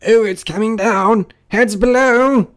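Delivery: high, loud cry of alarm; warning shout. Acted. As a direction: yell a warning, stretching the last words. Warning shout as slate falls.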